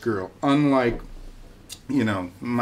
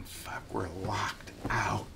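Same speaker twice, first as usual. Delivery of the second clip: whispered, slow and much softer; hushed curse; 5 semitones lower, 10 dB quieter, slower than usual. Retold or imitated. Locked out at night, cursing under his breath.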